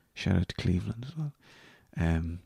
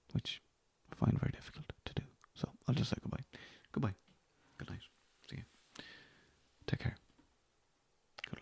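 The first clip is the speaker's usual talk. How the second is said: soft whispered goodbye; whispered sign-off; 5 semitones higher, 9 dB quieter, faster than usual. Real, not acted. Podcaster signing off to listeners, whispered. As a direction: hush it, shy about leaving.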